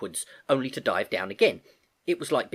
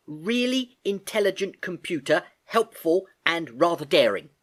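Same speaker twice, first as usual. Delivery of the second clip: higher, pleased, spelling out the acronym; proud explanation; 6 semitones higher, 4 dB louder than usual. Acted. Girl explaining the name to the computer, proud.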